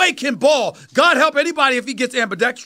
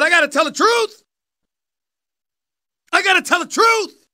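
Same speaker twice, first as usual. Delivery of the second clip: emphatic insistence; 4 semitones higher than usual. Real, not acted.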